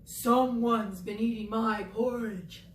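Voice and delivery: a hint of a gruff Papa Bear, slow; slow Papa Bear voice, lightly done; lightly voiced Papa Bear. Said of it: "he said".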